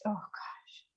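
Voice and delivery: whispered under the breath; exasperated whisper. Real, not acted. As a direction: let it slip out as a breathy aside. Reacting to bad accents.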